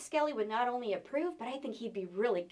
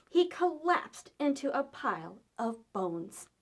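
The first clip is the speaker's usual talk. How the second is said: ordinary delivery, nothing marked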